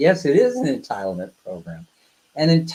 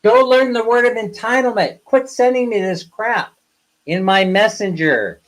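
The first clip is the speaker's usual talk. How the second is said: exasperated rant; 6 semitones higher than usual. Real, not acted. Ranting at senders of the meme.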